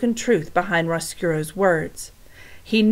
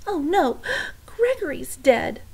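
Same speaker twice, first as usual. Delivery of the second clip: usual speaking voice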